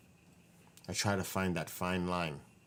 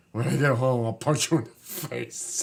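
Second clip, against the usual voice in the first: imitating angry mom; 3 semitones higher, 7 dB louder than usual. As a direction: hammer it like the mother did. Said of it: "That's how mad she was".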